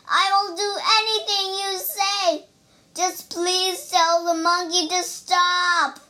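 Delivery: high, wailing plea; desperate begging. A narrator's reading. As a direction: whine loudly for mercy. Bear begging for the punching to stop.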